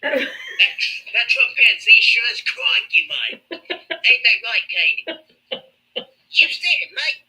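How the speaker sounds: high, laughing Australian wildlife host; hammed-up crikey showman; Aussie host. An Australian host urging Katie on in a high, laughing Aussie accent. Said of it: "Aussie host continues".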